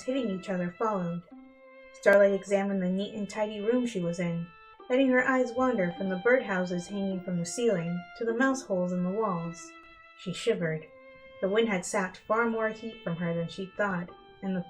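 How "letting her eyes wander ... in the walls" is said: plain reading voice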